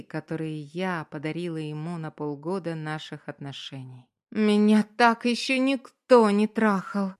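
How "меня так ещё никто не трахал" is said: loud, high moaning line; moaning loudly. Acted. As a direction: moan it out loud. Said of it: "и стонет ещё громче".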